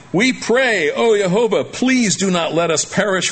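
high, urgent plea to God; crying out in prayer. Acted. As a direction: cry out to heaven, begging for mercy. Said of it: "They cried out to Jehovah".

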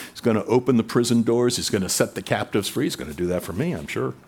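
ordinary talk, nothing marked

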